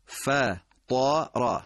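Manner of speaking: فطر stretched very slowly; slow chanted فطر; recited model. Shown as a demonstration. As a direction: give the fa and ta each their due in فطر. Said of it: "حرف قوی یا ضعیفش نکنیم".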